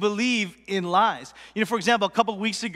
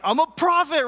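raised, loud, fired-up declaration; pumped up; 6 semitones higher, 4 dB louder than usual. Acted. Elijah finally answering God's challenge, pumped up. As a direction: belt it out with new confidence. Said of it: "he gets all pumped up".

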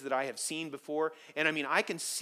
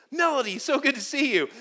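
high, bright mock welcome; eager greeting; 10 semitones higher, 6 dB louder than usual. Pastor pictures greeting Melody next year, mock-eager. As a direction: overdo the warmth.

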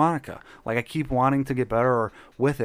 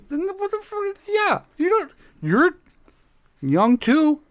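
high excited squeal; 6 dB louder, slower than usual. Acted. Same high character voice.

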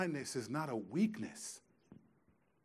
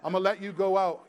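mom giving in; 5 semitones higher, 8 dB louder, faster than usual. Retold or imitated. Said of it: "almost every Sunday".

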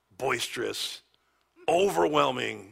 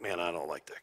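quiet buddy; 9 semitones lower, 7 dB quieter than usual. Retold or imitated. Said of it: "he's a sort of quiet guy".

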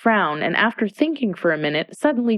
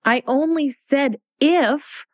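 a touch of piteous pleading, higher and slower; 8 semitones higher, slower than usual. A narrator's reading. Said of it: "pleaded in a piteous tone".

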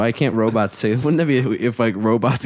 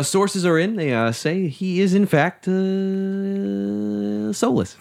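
mock news anchor; 9 semitones higher, much slower than usual.

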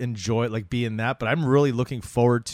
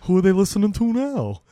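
listener voice; 6 semitones higher, 4 dB louder than usual. Retold or imitated. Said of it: "they're not tuning in to be like".

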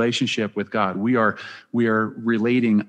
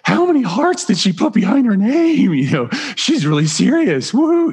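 giddy self-quote; 8 semitones higher than usual. Retold or imitated. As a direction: squeal it like a smitten teen who can't believe it. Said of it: "I was sort of like".

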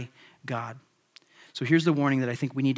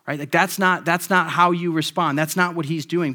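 normal talking voice

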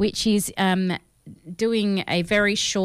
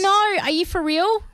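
very high-pitched disbelief; refusing to believe it; incredulous protest; 11 semitones higher than usual. Real, not acted. Protesting the ban on accents.

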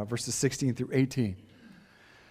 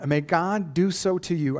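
reading slowly; 4 semitones higher, 4 dB louder, slower than usual. Shown as a demonstration. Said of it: "I have to read this slowly".

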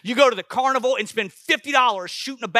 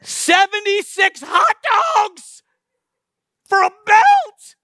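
much higher, louder and slow, disbelieving; incredulous shout; 13 semitones higher, 9 dB louder, much slower than usual. Real, not acted. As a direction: belt it out in mock outrage. Incredulous at hot dog contest.

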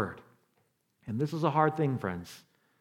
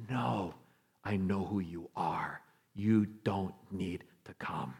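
softer, slow, near-whispered; hushed humble reply; 9 semitones lower, 12 dB quieter than usual. Retold or imitated. Centurion humbly telling Jesus not to come, hushed. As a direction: let the last words drop to a whisper.

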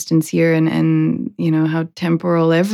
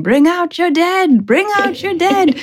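Monty Python cart man; 10 semitones higher than usual. Retold or imitated. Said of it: "with a cart going".

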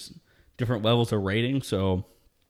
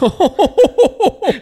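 loud, high cackle breaking out; can't hold the laugh in; burst of high laughter; 14 dB louder than usual. Real, not acted. Laughing at his own comeback to the guy, loud and high.

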